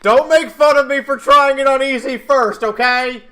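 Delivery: loud, pleading, a little embarrassed; loud plea. Real, not acted. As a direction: beg them loudly not to mock. Pleading before trying easy mode.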